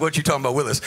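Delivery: a hint of the famous TV kid line, dropped low; mild mock sitcom catchphrase, low. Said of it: "He sounds like".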